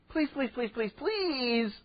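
small, high, quiet pleading; hushed mock pleading, drawing out the last please; earnest begging. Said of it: "they begged him earnestly".

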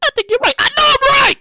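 high-pitched imitation of an agreeing fan, ending loud; mock listener voice. Host mimics a listener conceding he is right, high mock voice. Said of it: "and you're like".

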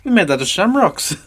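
high, hammed-up Irish accent. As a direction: ham up a bad Irish brogue. Continues the Irish bit.

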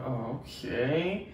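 slow, soft, resigned "okay"; deflated okay. Retold or imitated. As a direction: let it sink in, drawn out and quiet. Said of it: "I'm like".

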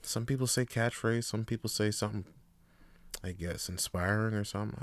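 ordinary talk

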